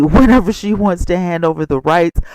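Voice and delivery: ordinary talk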